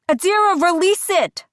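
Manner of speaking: loud, high yell of the name. A narrator's reading. Dina yelling at Adira to let go, loud. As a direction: shout her back to herself. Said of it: "Dina yells".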